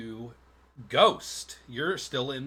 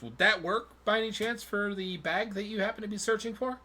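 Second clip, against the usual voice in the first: polite tanner; 8 semitones higher than usual. Acted. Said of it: "he goes".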